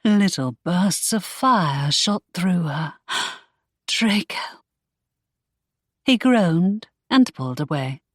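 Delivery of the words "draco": a sharp gasp, then the name breathed out unvoiced; breathless gasp. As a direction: gasp in surprise and whisper his name. Love scene, she gasps his name.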